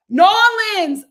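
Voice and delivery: "New Orleans" squeezed to NAW-linz, high and loud; attempted local pronunciation. Shown as a demonstration. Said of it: "I'm still saying it wrong".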